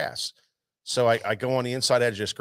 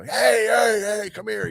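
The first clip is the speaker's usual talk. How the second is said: calling out; 9 semitones higher, 4 dB louder, slower than usual. Retold or imitated. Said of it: "they start yelling at me".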